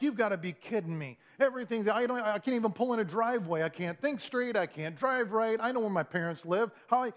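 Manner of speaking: losing it, line piled on line; exasperated meltdown. Retold or imitated. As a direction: act out a frustrated meltdown at yourself. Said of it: "had one of those meltdown moments".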